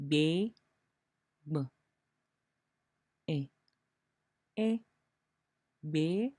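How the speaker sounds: "be" split into b and e, then joined again; sounding out be. Shown as a demonstration. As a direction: break "be" into its sounds and blend them back. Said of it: "For example".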